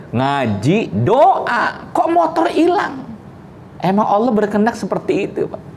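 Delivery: mock disbelief climbing up; incredulous. Preacher voices the father's disbelief at the stolen bike, incredulous.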